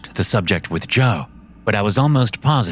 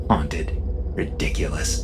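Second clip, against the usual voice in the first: dry, half-whispered scoff; muttering; 4 semitones lower, 6 dB quieter, slower than usual. Acted. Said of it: "I muttered with a half smile".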